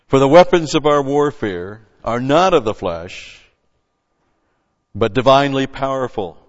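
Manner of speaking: loud, full-force preaching read; preaching scripture. Acted. Preacher reading scripture to his congregation, full force. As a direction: proclaim the verse to the congregation.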